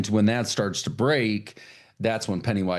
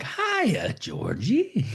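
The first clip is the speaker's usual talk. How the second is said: much higher sing-song clown voice; creepy clown greeting; 13 semitones higher, much slower than usual. Acted. Pennywise greets Georgie from the sewer, high creepy sing-song. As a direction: lure the child with a sweet sly hello. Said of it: "And he's like".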